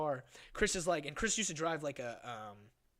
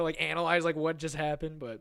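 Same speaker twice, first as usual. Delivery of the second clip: ordinary delivery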